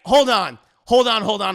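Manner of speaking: loud, repeated mock plea; urgent stalling. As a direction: stall the gunman loudly. Husband stalls man with gun.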